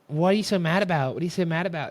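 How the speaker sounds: high-pitched imitation of pestering girls; mocking teasing girls. Girls pestering him during the game, mock teasing. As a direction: mimic girls needling, pitched well up.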